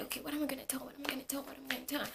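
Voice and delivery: frantic hushed muttering, over and over; anxious whispering to self. Retold or imitated. Student rehearsing excuses on the way, anxious whispering. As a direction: whisper it to yourself in a panic.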